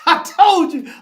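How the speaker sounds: loud, high gloating cry with a laugh; triumphant shout. Real, not acted. Fan gloating to viewers after the upset, loud and high.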